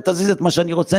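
ordinary talk

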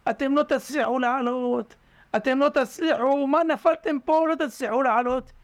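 high, mocking discouragement; discouraging jeer. Onlookers jeering at frogs in the pit, high and mocking. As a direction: tell them they'll never climb out.

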